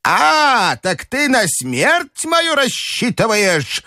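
very high, drawn-out accusation; outraged wail. A narrator's reading. Grandmother accusing the general of awaiting her death, high wail. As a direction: wail it slowly in a high old woman's voice. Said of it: "завопила бабушка генералу".

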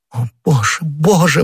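rising to a high desperate cry; anguished cry. A narrator's reading. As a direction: cry out overwhelmed. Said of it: "воскликнула Анна Австрийская". (отчаянное восклицание)

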